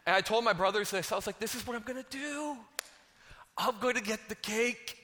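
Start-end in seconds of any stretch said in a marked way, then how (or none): 1.4-5.0 gleeful scheming boast. Acted. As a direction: boast about your master plan, pitched up. Boasts of his plan to brothers.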